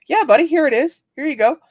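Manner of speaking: high, bright covering-up voice; fake cheerful. Covering for the blind friend, high and cheery. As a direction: hide the confusion with cheer. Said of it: "I went".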